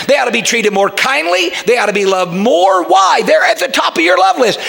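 high, emphatic pulpit exhortation; fervent preaching. Real, not acted. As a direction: drive the point home to the congregation, pitch climbing.

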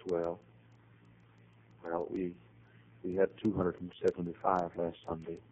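soft and hesitant; sad, hushed. Retold or imitated. Receptionist admits the decline, soft and sad. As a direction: speak with bowed head, softly. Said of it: "she bows her head".

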